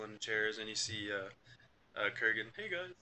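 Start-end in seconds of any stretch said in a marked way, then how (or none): 2.6-2.9 slightly soft, high-pitched hello; lightly voiced character greeting. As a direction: greet them in a higher, softer voice. Game master voices Kurgan greeting party.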